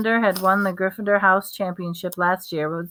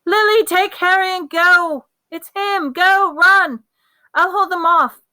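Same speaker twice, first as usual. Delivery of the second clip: high, loud panicked orders; panicked shout; 11 semitones higher, 7 dB louder than usual. A narrator's reading. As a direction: shout to save your family. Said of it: "a man's voice shouting, panicking".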